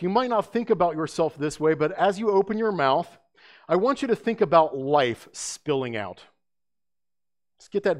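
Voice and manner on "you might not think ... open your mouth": ordinary talk, nothing marked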